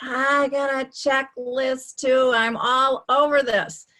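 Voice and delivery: smug, drawn-out boast; mock cocky confidence. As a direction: play the over-confident know-it-all, a bit loud. Retold smug confident thought.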